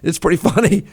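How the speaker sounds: real chuckle through the words; laughing. Real, not acted. Host laughs at the tweet, giggling. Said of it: "I'm laughing right now".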